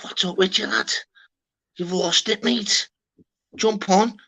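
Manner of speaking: mock exasperation at a mate; exasperated telling-off. Retelling telling lad off.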